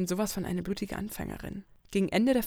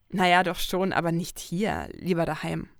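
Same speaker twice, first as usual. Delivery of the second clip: ordinary delivery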